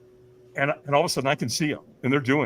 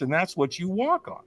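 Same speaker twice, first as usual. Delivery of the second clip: ordinary talk, nothing marked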